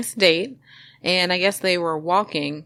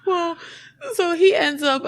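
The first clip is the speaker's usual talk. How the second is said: high, laughing voice; real laughter squeezing the voice high; talking through laughter; 12 semitones higher than usual. Real, not acted. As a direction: carry on while still laughing. Recovering from laughing at own story.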